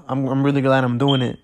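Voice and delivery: ordinary talk, nothing marked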